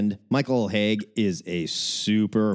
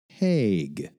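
ordinary delivery

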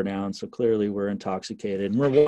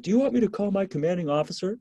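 higher, challenging cop; 10 semitones higher, faster than usual. Retold or imitated. Cop threatening to escalate.

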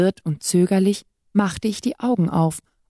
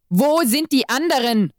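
angry demand, pitched higher; angry yell; 6 semitones higher than usual. A narrator's reading. Exorcist demands answers from his captive, angry and higher. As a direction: bark the question in anger. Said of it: "schrie er mich wütend an".

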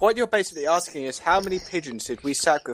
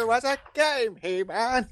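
high hammy villain voice; Skeletor; 6 semitones higher, 4 dB quieter than usual. Acted. Villain announcing a video game, high hammy voice. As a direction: proclaim it like a cartoon villain. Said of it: "keep doing Skeletor voices".

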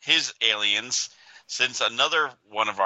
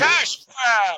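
mock shout; 12 semitones higher, 4 dB louder than usual. Mocking edition name, shouted.

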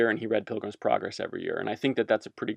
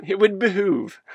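higher voice, giggling on the word; laughing through it; 9 semitones higher than usual. Real, not acted. Speaker savouring his favourite word, laughing. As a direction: let the laugh break into the phrase. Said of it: "get a behooved off my chest".

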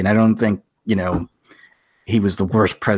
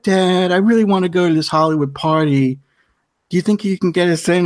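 higher, lightly voiced wheedling daughter; spoiled daughter; 8 semitones higher than usual. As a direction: beg dad like a pampered girl, hammed up. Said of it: "it's like".

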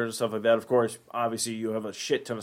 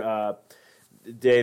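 ordinary talk

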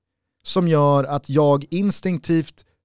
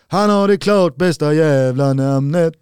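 Skåne accent; 4 dB louder than usual. Shown as a demonstration. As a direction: chant in a Skåne dialect. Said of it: "Du ser, det blir skånska".

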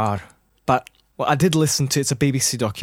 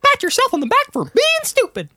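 Mickey Mouse voice; 5 dB louder than usual. Pretend. Doing Mickey voice.

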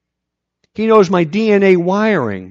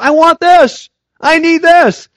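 put-on much higher and louder, a child insisting on what it wants; demanding child; 11 semitones higher, 6 dB louder, slower than usual. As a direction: whine it out loud like a kid who wants it now. Praying child begging God.

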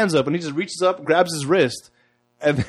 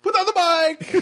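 very high pretend-panicked command; mock frantic order; faster than usual. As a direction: cry it out high, playing the clone fight. Joining the mock clone fight.

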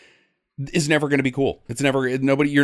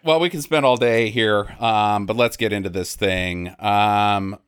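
ordinary talk, nothing marked

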